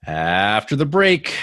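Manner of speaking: slow, drawn-out mock announcer; slow hammed-up link to the break; show break announcement. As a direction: stretch it out like a host's cue. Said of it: "So you could do that now".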